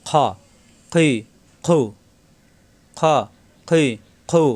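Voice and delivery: "খ, খি, খু" rasped full from the throat; heavy-mouthed kh opening each syllable; thick, heavy kh. Shown as a demonstration. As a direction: round and darken each "খ, খি, খু". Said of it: "মোটা হবে".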